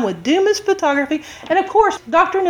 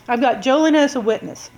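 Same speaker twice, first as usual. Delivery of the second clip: usual speaking voice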